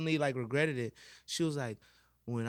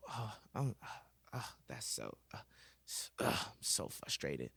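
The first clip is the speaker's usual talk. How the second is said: frustrated groan; 4 semitones lower, 9 dB quieter than usual. Retold or imitated. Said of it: "And she was just like".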